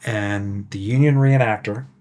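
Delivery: ordinary talk, nothing marked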